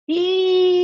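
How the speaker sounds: long, loud, high ee; sustained "ee". Shown as a demonstration. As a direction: project ee on one steady note. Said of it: "We're gonna say".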